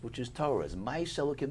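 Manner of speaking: ordinary delivery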